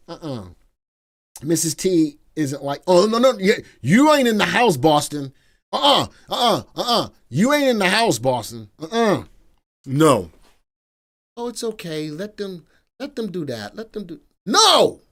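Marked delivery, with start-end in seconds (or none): 2.9-10.3 higher, louder grandparent telling-off; hammed-up stern no-nonsense scolding; mock scolding. Acting out scolding grandchild Boston. 11.4-14.2 soft, higher imitation of wife; gentle mock-pleading, quiet and high; indulgent wife. Voices indulgent wife excusing the kids. 14.5-15.0 loud, high shout; hammed-up grandpa outburst; shouted refusal. Grandpa overrules the indulgent wife.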